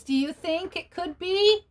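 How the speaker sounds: ordinary delivery, nothing marked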